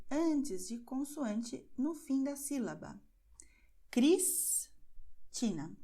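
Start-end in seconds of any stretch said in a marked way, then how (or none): 3.9-5.8 Cristina drawn out very slowly, syllable by syllable; slow demo word. Shown as a demonstration. Teacher shows the S sound to students, very slow demo. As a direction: spell out Cristina slowly for the learner. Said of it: "Veja que a sílaba termina com a letra S".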